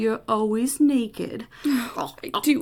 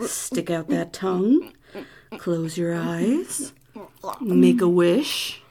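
slow; 4 semitones lower, slower than usual. Acted. Slow seductive instructions.

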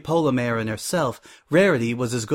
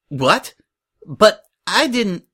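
high, slow, louder stammered protest; faltering disbelief; 8 semitones higher, 5 dB louder, slower than usual. Acted. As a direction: start to protest as the confidence falters. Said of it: "Suri's grin faltered".

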